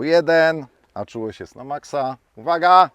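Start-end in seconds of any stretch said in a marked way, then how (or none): 2.4-2.9 loud sharp range warning; shouted command. Real, not acted. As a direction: bark it out as a command. Range command before the shot.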